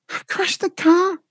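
very high, slow mock wife voice; put-on high voice. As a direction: squeak it like a man playing the wife. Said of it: "I just put on a high voice".

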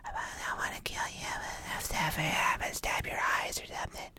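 a hushed, menacing ghost voice on the tape; creepy ghost whisper. Retold or imitated. Retelling a movie scene to his cohost, eerie whisper. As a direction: breathe it like a dark voice from a recording. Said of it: "and he's like".

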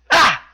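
short, high, loud yelp; one sudden punched "Ah!" in imitation; Tozawa's "Ah!" cry. Wrestler answers into the mic, short high imitated yelp. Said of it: "Tozawa simply says into the mic".

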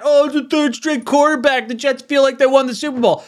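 high-pitched mocking imitation; mocking rival fans. Mimics rival fans in the chat.

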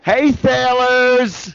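high, slow drawn-out call to the sailors; mock sailor call. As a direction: stretch it out like a campy sailor greeting. Mock ghost calling sailors.

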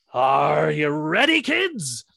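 put-on drawn-out, loud call leaping up on "kids"; slow build to a high-pitched finish; captain's rallying call. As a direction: imitate a stage captain rousing the kids. Acting captain's rousing call to audience.

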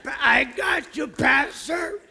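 hammed-up streetwise volunteer, calling out; eager street guy. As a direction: play the tough guy who'll break in for the pastor. Acted eager ex-gangster response.

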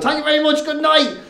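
high-pitched mock showman's farewell; stage sign-off. Performer leaving the stage after the parody, mock showman sign-off.

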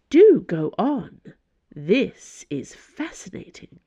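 slow, drawn-out mock interest; sarcastic. A narrator's reading. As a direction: invite her on with arch sarcasm, leaning on the first words. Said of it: "The cardinal raised a sarcastic eyebrow".

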